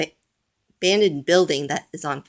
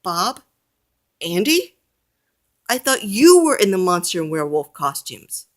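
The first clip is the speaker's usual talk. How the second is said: surprised chief; 3 semitones higher, 4 dB louder than usual. A narrator's reading. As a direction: sound baffled by the news. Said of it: "says the chief".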